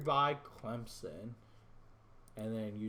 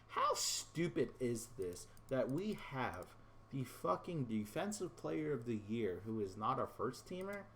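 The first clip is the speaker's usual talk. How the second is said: ordinary talk, nothing marked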